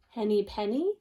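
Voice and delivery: normal talking voice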